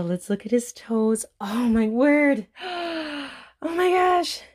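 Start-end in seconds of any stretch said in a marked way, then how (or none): 1.4-2.5 slow, louder exclamation of awe; awed. Real, not acted. Looking at the baby's toes, slow awed exclamation. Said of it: "all the gasping". 2.5-3.6 a sudden high gasp; high excited gasp. Real, not acted. Seeing the doll's toes, sudden high gasp. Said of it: "all the gasping". 3.6-4.4 high, loud burst of delight; thrilled gasp. Real, not acted. Mom unboxing her reborn doll, loud excited squeal. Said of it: "all the gasping".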